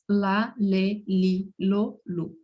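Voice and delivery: "Lá, lê, li, lo, lu" as an evenly spaced syllable drill; L plus vowel. Shown as a demonstration. Teacher drilling L before vowels, evenly recited syllables. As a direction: recite "Lá, lê, li, lo, lu" with a clear L on each. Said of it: "vai ter o mesmo som que em português".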